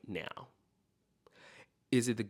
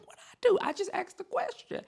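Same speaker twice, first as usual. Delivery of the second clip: very high-pitched, hurt little protest; protesting child; 5 dB louder than usual. Acted. Child answering a parent who snapped, high indignant protest. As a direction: squeal it like a kid wrongly snapped at. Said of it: "you have snapped".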